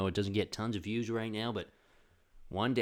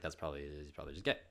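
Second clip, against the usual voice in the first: softer, lower, fast throwaway mutter; muttered aside; 7 semitones lower, 9 dB quieter, much faster than usual. Retold or imitated. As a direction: mumble it under your breath quickly. Retelling a quiet family assumption.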